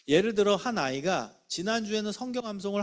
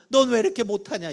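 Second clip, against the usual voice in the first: high, loud mock reproach; acted exasperated telling-off; scolding teacher; 6 semitones higher, 6 dB louder than usual. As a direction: imitate a teacher berating a pupil. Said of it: "이런 말만 듣지".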